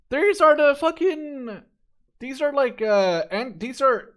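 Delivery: so thrilled he can't get the sentence out; breathless fanboy excitement; excited gushing. Real, not acted. Gushing over the flak vehicle.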